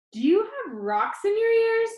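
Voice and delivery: high, loud exasperated jab; sting him with the question; exasperated scold. Acted. Grandma scolding her distracted grandson, exasperated and loud.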